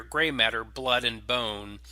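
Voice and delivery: ordinary delivery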